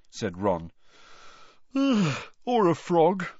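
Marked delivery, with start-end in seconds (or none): none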